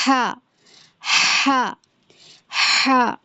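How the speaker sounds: ہا with clear breath on the palm; airy ہا, breath felt in front of the mouth; breathy ha. Shown as a demonstration. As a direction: push air out on each ہا. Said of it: "آپ اپنا ہاتھ منہ کے سامنے رکھیے گا".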